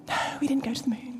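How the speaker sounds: put-on quick, whispered childish reply; giggly whispered denial. Daughter answering mum's moon question, in a quick whisper. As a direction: whisper it like a child holding back a laugh.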